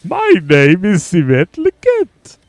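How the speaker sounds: high, swooping, slow greeting; weird Simet Liket voice. Pretend. Streamer settling on the character's weird voice, high and drawn out. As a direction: introduce yourself in a weird, high put-on voice. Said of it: "It's just that weird".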